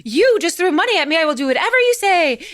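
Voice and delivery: put-on boy eagerly agreeing after the money. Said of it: "the boy's like".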